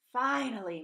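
sighing finally after years of waiting; relieved sigh. A narrator's reading. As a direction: breathe it out with relief at last. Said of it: "Mom sighed, relief in her voice".

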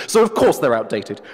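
a hint of a haughty professor; pompous wolf. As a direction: play the wolf as a self-important academic. The wolf quizzes.

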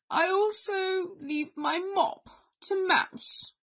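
solemn invalid bear, leaving suspense; will-dictating bear. A narrator's reading. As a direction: draw out each bequest with ceremony. Bear continues his will.

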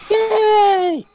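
very high, loud yay; excited squeal. Real, not acted. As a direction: squeal with delight. Cheering in game.

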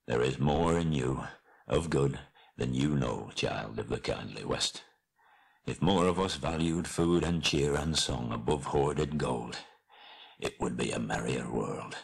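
low, fading farewell; dying dwarf king, solemn. Acted. Dying Thorin parting in friendship from Bilbo, solemn and fading. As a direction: let the voice sink and soften towards the end.

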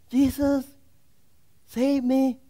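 high squeaky cry for help. Acted. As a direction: call out in a thin squeaky voice. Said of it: "there's this squeaky voice".

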